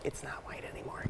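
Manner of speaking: a breathy whispered aside, voice dropped right out. Real, not acted. Painter whispering an aside to her co-host. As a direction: whisper it half to yourself.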